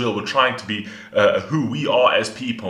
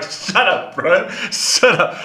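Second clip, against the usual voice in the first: higher mock annoyed "shut up, bro"; imitating Sloth; 7 semitones higher, slower than usual. Mimicking Sloth stopping the rapper, mock annoyed. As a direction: playfully parrot the guy cutting the rap off. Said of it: "Just like".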